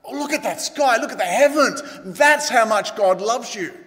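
high, excited wonder; lifted, emphatic amazement, slowing to a close; awed exclamation. Acted. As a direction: marvel aloud at the vast sky. Acts out pointing to the vast sky.

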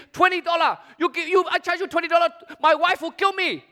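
high, aghast haggling seller; protesting shopkeeper. Acted.

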